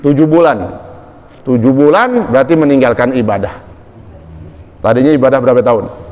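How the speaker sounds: ordinary talk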